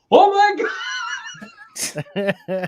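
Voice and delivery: sudden high shout of realization; mock-stunned yell as it clicks; retold shocked outburst. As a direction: blurt it out loud and very high.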